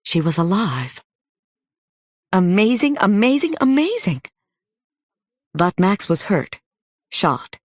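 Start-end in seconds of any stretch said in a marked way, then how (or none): 2.3-4.2 amazed. A narrator's reading. Girl marvels she is alive.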